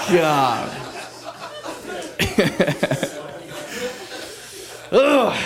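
ordinary talk, nothing marked

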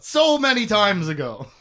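exasperated call-out. Real, not acted. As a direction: wail it in exasperation, falling away. Mock exasperation at overdue idea.

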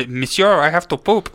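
high, loud mock French accent; snooty poodle voice. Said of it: "the one French poodle that he goes by".

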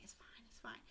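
low, very soft mock soothing; whispered shifty reassurance; hushed reassurance. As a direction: brush it off under your breath. Con men soothe Pinocchio.

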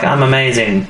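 ordinary delivery

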